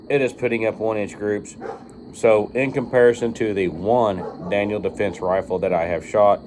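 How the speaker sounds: ordinary delivery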